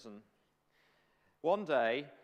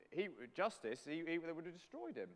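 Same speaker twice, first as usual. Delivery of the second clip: ordinary talk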